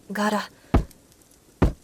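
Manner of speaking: slow stretched sliding-door sound; drawn-out door rattle. A narrator's reading. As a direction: voice the door grinding open slowly.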